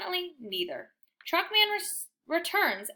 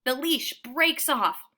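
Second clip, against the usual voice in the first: ordinary delivery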